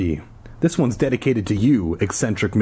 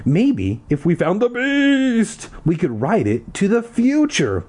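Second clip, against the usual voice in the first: much higher, slow; high slow suggestion; 10 semitones higher than usual. A narrator's reading. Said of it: "I suggested".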